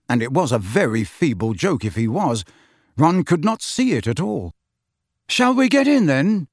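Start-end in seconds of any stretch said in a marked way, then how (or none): 5.3-6.5 tentative, high-pitched suggestion; unsure, worried boy; uncertain. A narrator's reading. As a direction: ask warily, voice lifted. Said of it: "said Ron uncertainly".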